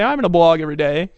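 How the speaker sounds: loud, eager mock vow; mock promise. Mocking a member's empty promise, loud and eager. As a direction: parody the eager newbie.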